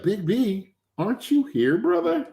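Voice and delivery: imitating a baffled friend, voice climbing high; high-pitched incredulous phone reply; puzzled shop worker. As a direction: ask in mock bewilderment, shooting up at the end. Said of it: "And he's like".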